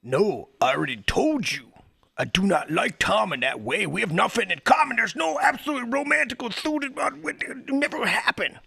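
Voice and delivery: protesting too much. Acted. Acting out the example denial.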